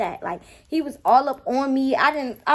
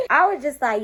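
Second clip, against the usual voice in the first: high loud laugh spilling into words; burst of laughter; 8 semitones higher, 7 dB louder than usual. Real, not acted. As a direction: crack up while talking. Laughing at own story.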